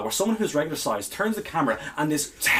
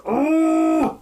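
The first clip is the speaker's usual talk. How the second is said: high drawn-out groan; 12 semitones higher, 5 dB louder than usual. Real, not acted. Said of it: "You sound pretty positive to me".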